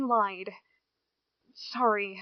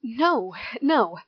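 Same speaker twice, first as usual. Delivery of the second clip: louder, pleading denial; a sudden loud protest; urgent loud no; 10 dB louder than usual. Acted. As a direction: blurt it out to stop her leaving. Riley pleads not to lose friend.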